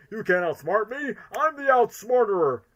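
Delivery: high, rising villain boast; hammy cartoon-villain gloating; Eggman. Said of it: "I like the one line delivery".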